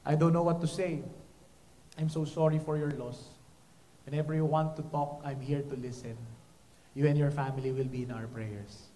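low, soft words of condolence; sympathetic. Shown as a demonstration. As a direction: speak gently to a mourner. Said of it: "Let me give you some examples".

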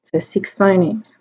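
ordinary talk

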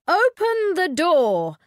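high, loud queenly order; firm royal command. A narrator's reading. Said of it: "said the Queen".

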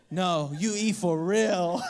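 put-on slow low kid voice through laughter; stern toddler, laughing. Dad laughs as he again voices his son's low, slow order. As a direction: insist slowly while laughing. Son repeats demand.

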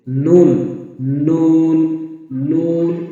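নুন held, tongue tip just above the upper gum; letter articulation demo. Shown as a demonstration. Teacher drills learners, sustained নুন from upper gum. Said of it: "এভাবে উচ্চারণ করবেন".

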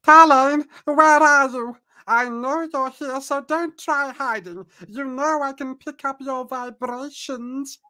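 very high, slow put-on voice; high-pitched sorceress. Sorceress hunting someone in hiding, high-pitched drawn-out voice. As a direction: call out high and loud, then ease off. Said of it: "you hear a high-pitched voice".

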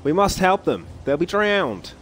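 put-on high, urgent boy's cry; storybook boy calling out in alarm; acted yell. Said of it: "Storm Boy yelled".

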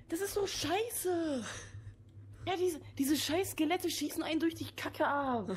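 high, slow drawn-out whining; frustrated whine. Real, not acted. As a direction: moan about the unfair game. Stirbt immer wieder, frustriert. (langgezogenes frustriertes Jammern)

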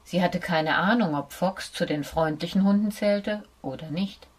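ordinary delivery, nothing marked

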